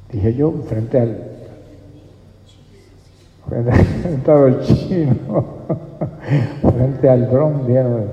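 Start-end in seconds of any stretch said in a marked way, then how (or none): none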